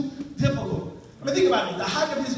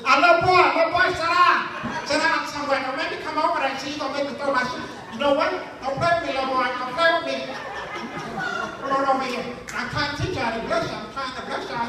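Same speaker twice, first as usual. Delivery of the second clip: Madea going off; 3 semitones higher than usual. Acted. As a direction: threaten the restless kids. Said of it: "Madea starts to go off".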